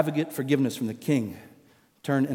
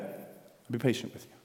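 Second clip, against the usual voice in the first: whisper; 3 semitones lower, 11 dB quieter than usual. Acted. The same words, repeated softly.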